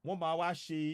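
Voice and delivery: ordinary delivery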